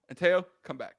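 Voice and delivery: ordinary talk, nothing marked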